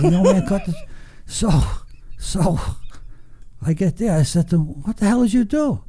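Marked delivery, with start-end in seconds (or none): 4.8-5.8 pitched way up in disbelief; shocked disbelief. Retold or imitated. Son to his father over the felled tree, stunned and high. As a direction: gasp out what he's done.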